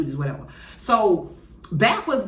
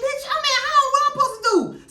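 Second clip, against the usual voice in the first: flustered panic; 4 dB louder, faster than usual. Retold or imitated. Panicked reply on the phone.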